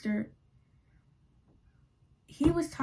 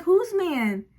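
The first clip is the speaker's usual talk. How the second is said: sassy question; 7 semitones higher, 4 dB louder than usual. Retold or imitated. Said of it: "I'm like".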